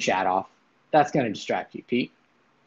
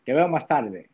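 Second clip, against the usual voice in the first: Spanish phrase. Shown as a demonstration. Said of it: "a whole stream in Spanish".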